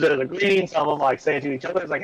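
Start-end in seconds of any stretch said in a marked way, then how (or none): none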